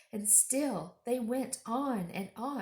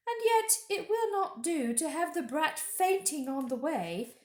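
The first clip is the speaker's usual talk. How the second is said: higher witch voice, musing aloud; witch to herself; 8 semitones higher, 4 dB louder than usual. Acted. Witch deciding to feed Edmund, musing. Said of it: "as if to herself".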